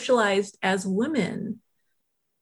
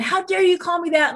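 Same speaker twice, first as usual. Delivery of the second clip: higher mock outrage; indignant woman; 8 semitones higher than usual. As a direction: throw her outrage back at her. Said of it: "we were going at it".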